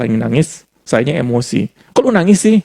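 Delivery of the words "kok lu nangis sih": high, louder exasperated question; annoyed retort. Retold or imitated. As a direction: toss it off, irritated at her tears. Quotes himself annoyed at wife. (suami kesal menegur istri yang menangis, nada tinggi jengkel)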